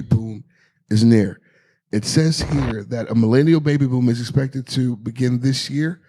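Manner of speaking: low-pitched headline read-out; news read. Real, not acted. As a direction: read the article out evenly. Reads out a news item.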